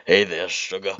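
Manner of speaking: loud, drawn-in Southern flirt; seductive Rogue. Acted. Rogue greets Sonic as he naps, seductive character voice. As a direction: greet him as a sultry Rogue.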